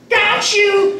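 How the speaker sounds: put-on very high little-girl squeal; gleeful prankster daughter. Daughter runs up to reveal her trick, high child shout. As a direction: squeal it like a kid springing a trick. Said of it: "the daughter runs up and goes".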